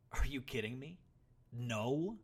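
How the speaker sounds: loud, high disbelief; almost yelling. A narrator's reading. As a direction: nearly yell it at him. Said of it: "The boy was almost yelling now".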